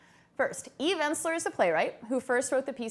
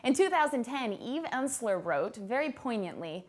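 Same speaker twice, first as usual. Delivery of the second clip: usual speaking voice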